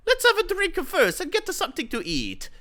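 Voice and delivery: fast, high, loud shout. Acted. Isaac shouting to Bruce, loud fast shout. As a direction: shout it cheerfully across the booth. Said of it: "shouted Isaac".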